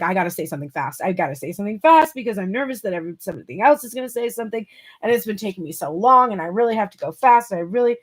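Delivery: fast, breathless nervous babble; nervous rushing. Retold or imitated. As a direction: gabble it out like you're scared to be interrupted. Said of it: "feeling really rushed".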